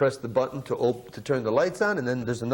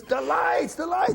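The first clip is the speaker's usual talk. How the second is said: much higher, urgent shout of the lights; retold yelling; 14 semitones higher, slower than usual. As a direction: bark it at the guy on the buttons. Bandleader yelling about the lights.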